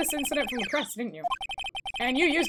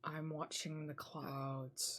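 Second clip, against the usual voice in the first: much lower, much softer, very slow; cold repeated snub; 7 semitones lower, 15 dB quieter, much slower than usual. Acted. Witness repeating herself to the lawyer, slower and colder. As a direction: drag it out, utterly unbothered. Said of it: "Perhaps you didn't hear me".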